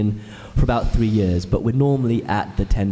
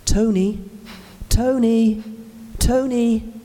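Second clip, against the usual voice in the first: shouting a name; 9 semitones higher, slower than usual. Retold or imitated. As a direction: call your father's name across a crowd. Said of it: "So I started shouting instead".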